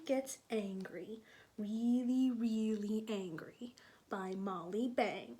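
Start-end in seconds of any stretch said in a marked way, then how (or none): none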